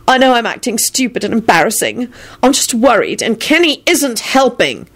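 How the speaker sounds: mildly tearful complaint; sniffy. A narrator's reading. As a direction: admit it while still upset. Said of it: "she sniffed".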